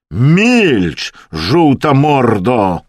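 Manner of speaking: loud, drawn-out roar; outraged shouted retort; angry shout. A narrator's reading. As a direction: bellow the insult slowly. Russian's furious retort to the jibe.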